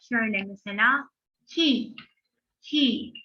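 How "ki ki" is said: ki said short and slightly higher; syllable ki demo. Shown as a demonstration. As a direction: model the syllable ki twice. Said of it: "ki diye okuyacağız".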